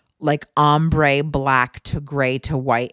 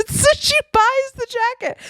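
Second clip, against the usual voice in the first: cracking up. Real, not acted. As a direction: voice climbing high into laughter. Cracking up while retelling mom's story.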